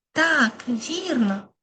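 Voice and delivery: ordinary talk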